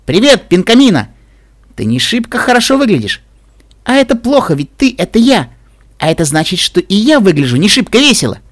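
high, gleeful cartoon-pony voice; happy chirping. A narrator's reading. Mirror Pinkie greets Pinkamena, happy chirping. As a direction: chatter it brightly and happily. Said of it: "счастливо прокричала Пинки Пай из зеркала".

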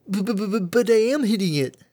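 high stammering protest; flustered stammer. Acted. Derpy stammers defensively.

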